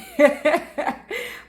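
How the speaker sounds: high, amused laugh. Real, not acted. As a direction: laugh at your own remark. Laughs at own garlic remark.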